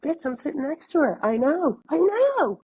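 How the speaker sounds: exasperated inner retort. Retold or imitated. Silently answering her friend in class, mounting exasperation.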